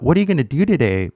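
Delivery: fast, "What are you gonna" slurred together; fast casual speech. Shown as a demonstration. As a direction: rattle it off like a native. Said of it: "might sound really fast to your ear".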